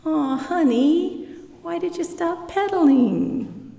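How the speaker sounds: smiling father. Shown as a demonstration. Father smiling at his daughter learning to ride, tender. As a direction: smile at your little girl. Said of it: "like a father who's smiling at his daughter".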